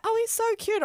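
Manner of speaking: high, gushy onlooker imitation; mock cooing. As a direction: coo like a smitten stranger. Imitates cooing couple at next table.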